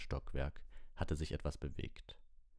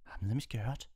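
weakly; 7 semitones higher than usual. Acted. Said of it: "sagte Ron schwach".

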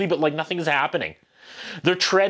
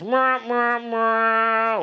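high sung mwah mwah mwah, falling; mock sad trombone sting; 5 semitones higher, much slower than usual. Reviewer mocking the lesson with a falling mwah mwah. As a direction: ham up a sad trombone fail sound.

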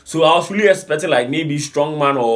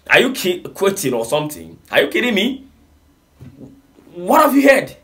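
rising higher, are-you-kidding scorn; high, mocking disbelief at the reply; incredulous mockery; 6 semitones higher than usual. Real, not acted. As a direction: scoff at him, voice climbing.

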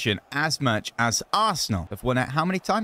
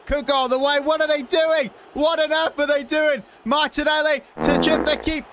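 excited exasperated shouting; frantic shout; 9 semitones higher than usual. Real, not acted. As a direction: urge the attack on. Frantic chance on goal.

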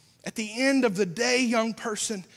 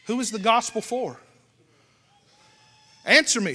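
ordinary talk

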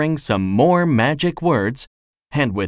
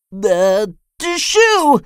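sneezing; 8 semitones higher, 4 dB louder, slower than usual. A narrator's reading. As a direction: sneeze bursting out mid-word, high. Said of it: "who had started sneezing again".

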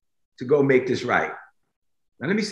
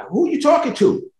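much higher, unbelieving squeak; incredulous Gideon; 11 semitones higher than usual. Acted. Gideon disbelieving the angel, high and incredulous. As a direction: ask it in disbelief. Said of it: "The guy is admitting, like".

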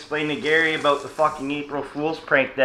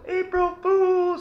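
very high, slow drawn-out call; yelled out window; much slower than usual. Retold or imitated. Cree mock-calling after him from the window, very high and drawn out. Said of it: "I'll yell out the window".

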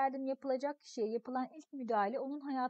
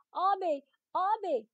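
calling out in alarm, higher and louder; frightened calling; 6 semitones higher, 7 dB louder than usual. Acted. Boy calling his fallen brother, frightened cry. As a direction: cry out to the hurt brother in panic.

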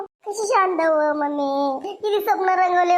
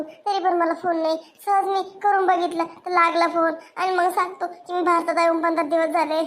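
normal talking voice

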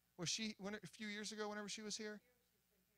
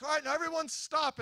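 leader calling out; 9 semitones higher, 11 dB louder than usual. Retold or imitated. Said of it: "she's like".

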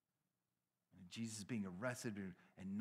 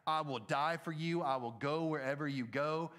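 louder, higher declaration of loyalty; bold vow; 4 semitones higher, 6 dB louder than usual. Acted. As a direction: pledge it emphatically, as a zealous disciple. Acting Peter's bold vow to Jesus.

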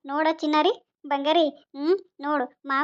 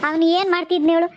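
ordinary delivery

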